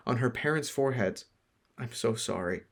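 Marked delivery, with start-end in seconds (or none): 1.8-2.6 soft, hushed apology; whispering. Acted. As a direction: whisper it to the dead. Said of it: "he whispered to them".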